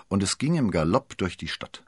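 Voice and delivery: ordinary delivery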